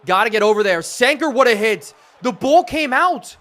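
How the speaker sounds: high, excited shouts of celebration; elated play call. Real, not acted. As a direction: erupt as the turnover happens. Live call of a forced fumble.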